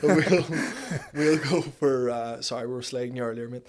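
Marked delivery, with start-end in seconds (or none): 0.0-2.1 loud laugh breaking through the words; words spilling out through real laughter. Real, not acted. Laughing at friend's nickname.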